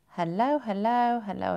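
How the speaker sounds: a lightly done, sing-song Scottish hello; mock Scottish accent. Greeting viewers in a slight mock Scottish accent. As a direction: play at a Scottish greeting without really committing. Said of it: "I sound Scottish".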